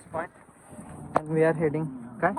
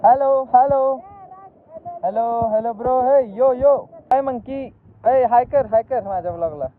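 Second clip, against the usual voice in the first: higher, louder playful calls; calling to monkeys; 9 semitones higher, 6 dB louder than usual. Real, not acted. Greeting the corner monkeys, loud playful calling. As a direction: hail the monkeys across the gap.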